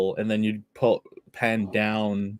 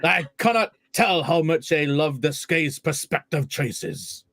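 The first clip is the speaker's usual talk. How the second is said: put-on a lightly done Scottish accent, pitched up at the start; mock Scottish reading of a fan tweet; best Scottish accent; 5 semitones higher than usual. [name] reads the tweet aloud for the show in a light Scottish accent. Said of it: "[name], do your best Scottish accent".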